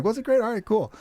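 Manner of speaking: high put-on voice; mock sheepish reply. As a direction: echo them back in a high silly voice.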